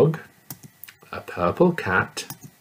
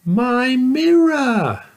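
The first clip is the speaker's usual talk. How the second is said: put-on much higher small girl's voice; little girl answering the giant; 6 dB louder, much slower than usual. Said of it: "said the little girl".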